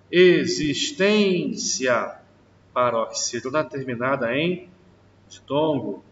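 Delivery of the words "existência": slow drawn-out word. Shown as a demonstration. Teacher slowly stretches the example word for the class. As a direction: stretch every syllable slowly. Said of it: "teacher drawing out example word".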